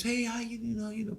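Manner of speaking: high, soft sweet lady voice. Retold or imitated. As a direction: lightly imitate a gentle old lady's greeting. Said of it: "Lady has a real nice, sweet voice".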